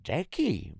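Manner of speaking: very high, loud parody whine; mocking mimicry. A narrator's reading. Older sister apes her sister's call, mocking. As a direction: mimic a little sister's cry. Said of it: "przedrzeźniała mnie".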